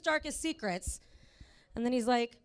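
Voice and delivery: ordinary talk, nothing marked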